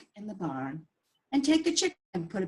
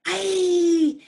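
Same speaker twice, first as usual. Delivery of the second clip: high, loud comic wail; exasperated cry; 9 semitones higher, 7 dB louder than usual. Acted. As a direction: burst out at the noise.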